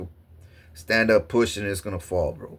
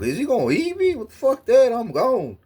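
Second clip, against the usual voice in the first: very high panicked squeal; hammed-up fear as the bear charges; terrified; 3 dB louder than usual. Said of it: "I was terrified".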